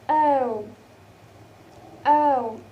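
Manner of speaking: "o" as in cow, a long falling glide; diphthong demo. Shown as a demonstration. Teacher showing the vowel in cow, drawn-out demo. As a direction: model "o" slowly, as the vowel in cow. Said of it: "And the next is".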